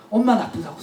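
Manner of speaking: usual speaking voice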